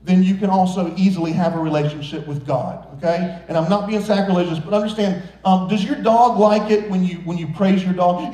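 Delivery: normal talking voice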